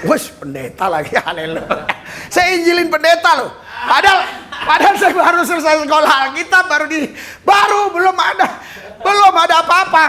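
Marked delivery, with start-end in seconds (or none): 2.3-10.0 high, laughing exclamation; laughing disbelief. Real, not acted. Preacher to congregation, amused at evangelizing a pastor, laughing high.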